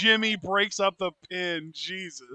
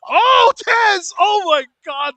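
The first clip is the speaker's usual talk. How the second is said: excited shout; 12 semitones higher, 13 dB louder, slower than usual. Real, not acted. Excited reaction to a wrestler.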